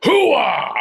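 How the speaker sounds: loud gung-ho soldier bark; Al Pacino. Retold or imitated. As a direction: belt out the war cry high. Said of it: "Al Pacino will probably do it".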